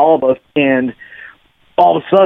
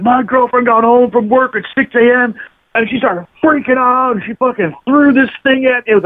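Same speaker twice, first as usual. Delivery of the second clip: high-pitched, worked-up retold complaint; agitated boyfriend, imitated; 9 semitones higher than usual. Bloodied boyfriend explains his girlfriend's outburst, imitated agitation.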